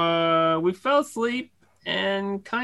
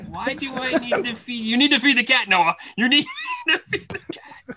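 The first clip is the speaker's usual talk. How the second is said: scolding mom; 5 semitones higher than usual. Retold or imitated. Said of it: "totally yelled the junk out of him".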